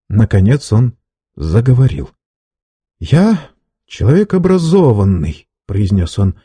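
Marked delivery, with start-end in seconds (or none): 3.0-5.5 slow, picking words. A narrator's reading. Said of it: "медленно подбирая слова".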